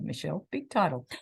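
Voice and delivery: ordinary delivery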